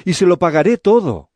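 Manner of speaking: high, earnest promise to repay; pleading. Acted. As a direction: keep begging, pitched well up. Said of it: "le rogó". (ruego agudo)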